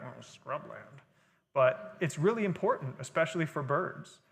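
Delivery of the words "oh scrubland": quiet dismissive mutter; softly unimpressed, mock disdain; derogatory. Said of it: "kind of sounds derogatory".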